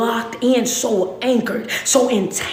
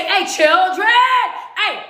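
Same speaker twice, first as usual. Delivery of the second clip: bishop calling out; 8 semitones higher than usual. Retold or imitated. Bishop calling rowdy children to order from pulpit, shouting. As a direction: call the kids to order from the pulpit. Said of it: "the bishop would say".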